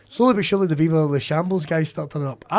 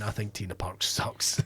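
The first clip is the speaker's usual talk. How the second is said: muttered verdict; 5 semitones lower, 9 dB quieter than usual. Retold or imitated. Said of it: "I'd already pretty much went".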